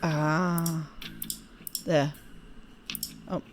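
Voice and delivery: ordinary talk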